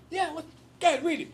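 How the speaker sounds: high, loud cartoonish barfly; comic bar regular; barfly impression. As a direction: egg him on in a put-on, high voice. Voices the barflies in retelling.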